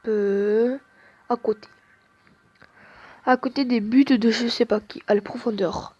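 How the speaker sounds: ordinary delivery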